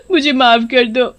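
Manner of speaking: high, sobbing plea for mercy; tearful pleading. Acted. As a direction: beg through frightened sobs. Wife begs the ghost for mercy. (रोते हुए गिड़गिड़ाना)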